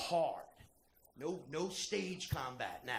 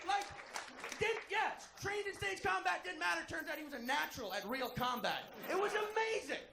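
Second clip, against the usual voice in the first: excited; 12 semitones higher than usual. Real, not acted. Excited fight retelling.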